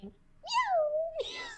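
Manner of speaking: high squeaky meowing; cat meow. Retold or imitated. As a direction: mimic a synth cat meowing. Miku voice meowing in clip.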